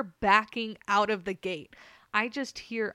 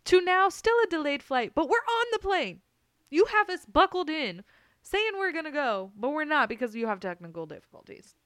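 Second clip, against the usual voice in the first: higher and louder, indignant; exasperated rant; 6 semitones higher, 5 dB louder than usual. Retold or imitated. Complaining to the pilot about the delay, exasperated. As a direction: vent the outrage at the pilot.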